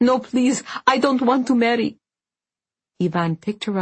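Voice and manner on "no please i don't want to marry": high-pitched, desperate plea; distressed daughter protesting; crying out. A narrator's reading. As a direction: beg him in rising panic. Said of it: "she cried".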